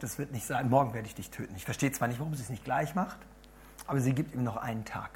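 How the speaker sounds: ordinary delivery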